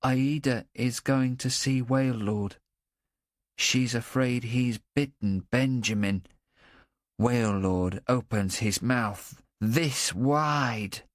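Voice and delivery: put-on slow, earnest boy voice; serious child. A narrator's reading. Boy warning Kennet about Waylord, slow and serious. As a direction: tell it gravely as the boy. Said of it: "Then he says seriously".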